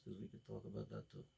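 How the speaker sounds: dark low mutter of a threat; menacing low voice. Acted. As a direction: drop into a creepy threat. Sudden menacing aside in quote.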